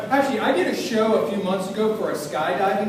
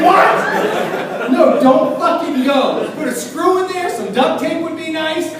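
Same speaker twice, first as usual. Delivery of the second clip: high, incredulous protest; mock alarm; 8 semitones higher, 4 dB louder, much slower than usual. Passenger overhearing the mechanic, high alarmed protest. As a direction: plead in comic disbelief, pitched up.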